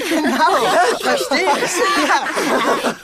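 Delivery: hoch und lachend vor Freude; lachend begeistert. Acted. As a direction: die Lösung lachend feiern. Excited laughing at solution. (hoch und lachend vor Freude)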